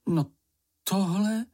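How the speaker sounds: incredulous exclamation; exclaiming. A narrator's reading. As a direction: cry out in mild disbelief. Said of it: "zvolal Mumínek".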